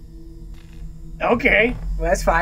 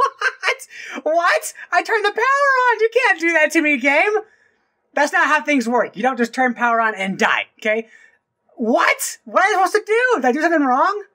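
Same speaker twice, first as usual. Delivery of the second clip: stunned outrage; 13 semitones higher than usual. Real, not acted. Player complaining to the game in high stunned disbelief. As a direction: protest at the game, baffled.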